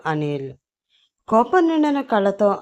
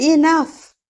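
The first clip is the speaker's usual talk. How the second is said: sudden high, loud bark of enough; loud shout; 9 semitones higher, 7 dB louder than usual. A narrator's reading. CEO silences the office with a loud shout. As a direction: shut everyone up at once. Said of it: "అంటూ గట్టిగా అరిచాడు అతను".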